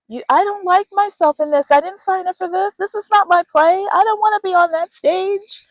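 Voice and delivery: high, put-upon whine of someone stuck on stage; whiny client voice. Pretend. Client stuck on stage in the wrong play, whining high. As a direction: pitch it up and complain like you're trapped in the wrong play. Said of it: "you're like".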